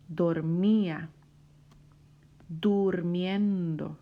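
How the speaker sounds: slow, separate words with long pauses; read-along word list. Shown as a demonstration. Teacher reads the last list with student, slow model reading. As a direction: enunciate each verb for a learner reading along. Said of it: "Quiero que la leas conmigo".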